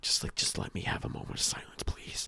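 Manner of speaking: whispered plea for silence; whispering. Real, not acted. Fed up with the noisy kids, whispering for a moment of silence. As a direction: breathe it out, begging for quiet. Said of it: "whispered plea".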